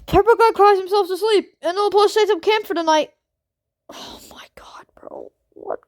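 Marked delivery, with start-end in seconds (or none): 3.9-5.8 breathless whisper of disbelief, slowing at the end; stunned whisper. Real, not acted. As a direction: hush it out, too shocked to speak up. Stunned reaction to game event.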